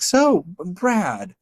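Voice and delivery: lightly voiced kind judge; gentle curious question. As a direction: ask warmly as the justice did. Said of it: "she's like".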